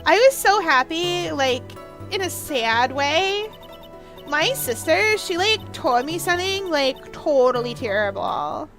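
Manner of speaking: put-on drawling Valley Girl testimony; Valley Girl accent. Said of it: "the return of the Valley Girl accent".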